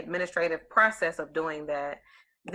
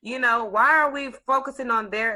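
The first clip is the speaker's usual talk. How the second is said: mock exasperation; 6 semitones higher, 5 dB louder than usual. Acts out own exasperated reaction.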